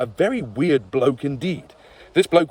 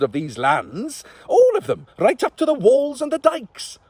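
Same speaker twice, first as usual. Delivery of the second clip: put-on old witch; 13 semitones higher than usual. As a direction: exclaim like an amazed old crone. Witch marvels at king.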